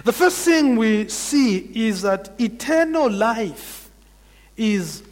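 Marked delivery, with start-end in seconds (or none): none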